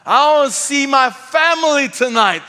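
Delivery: loud, high, drawn-out yell; screaming and shouting. Retold or imitated. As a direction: imitate a drunk passenger's outraged shouting. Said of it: "who started screaming and shouting".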